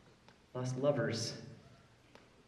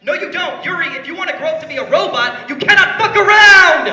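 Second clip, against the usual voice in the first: full yell, much higher and louder, slowing on the end; furious mock yell; 12 semitones higher, 14 dB louder than usual. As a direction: explode like an over-the-top coach. Rant peaks in a yell.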